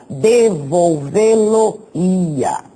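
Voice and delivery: "devolvê-lo-ia" said very slowly, syllable by syllable; slow demo word. Shown as a demonstration. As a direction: spell out "devolvê-lo-ia" slowly for the class. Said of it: "na forma do pretérito".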